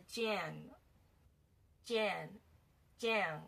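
"Jan" drawn out slowly with a nasal ending; nasal syllable demo. Shown as a demonstration. Teacher joining the sounds for learners, slow nasal syllable. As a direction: stretch each "Jan", separate and deliberate. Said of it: "有一个鼻音".

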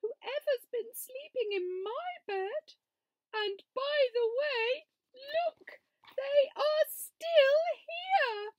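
very high, loud, slow cub voice; a tiny bear squeaking in alarm; high squeaky cub, not whispered at all; Small Bear. A narrator's reading. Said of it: "whispered Small Bear".

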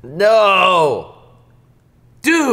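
long, very loud cry of protest; dismayed shout. Real, not acted. Participant reacting to a bad spin, loud dismay. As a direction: wail it out in disbelief.

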